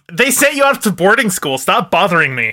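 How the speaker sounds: high, irritated mock retort; exasperated brush-off. Producer fobbing off a pestering actress, mock exasperated. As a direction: shut down the complaint in mock annoyance.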